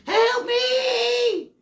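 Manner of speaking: tiny shrill cry for help from inside the turkey; stuffing begging for rescue in a high squeal. Pretend. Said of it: "sticks out the bird and says".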